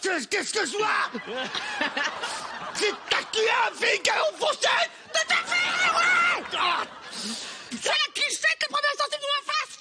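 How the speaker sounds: furious high shouting; angry ad. Acted. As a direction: scream the sales pitch in rage. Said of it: "Cuichette, énervé". (pub hurlée, furieuse et aiguë)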